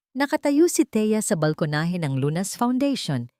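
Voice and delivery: ordinary delivery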